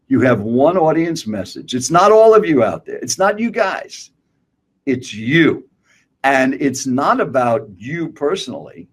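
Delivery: normal talking voice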